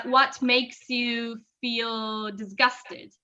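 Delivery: slow and clear, each word laid out; slow model sentence. Shown as a demonstration. Teacher slowly models the corrected question for a student. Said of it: "the correct sentence would be something like".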